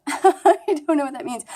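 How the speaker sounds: said through a laugh, lifted pitch. Real, not acted. Speaker laughs off her own puzzling remark, laughing. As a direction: laugh while admitting it.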